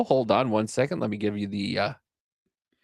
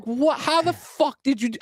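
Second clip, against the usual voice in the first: higher, stunned disbelief; 9 semitones higher than usual. Retold or imitated. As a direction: gasp it out in amazement. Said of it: "I was like".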